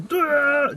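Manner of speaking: high loud strained grunt; straining grunt. Retold or imitated. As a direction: grunt hard as if moving things with your mind. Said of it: "the other antichrist going".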